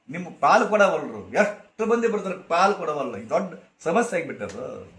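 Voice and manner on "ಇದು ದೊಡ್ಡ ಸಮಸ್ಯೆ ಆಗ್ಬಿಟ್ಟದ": ordinary talk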